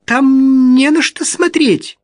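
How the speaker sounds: struggling to keep her voice steady; voice breaking. A narrator's reading. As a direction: voice cracking, loud and high. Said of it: "голос Рут срывался".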